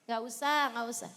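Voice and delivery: ordinary talk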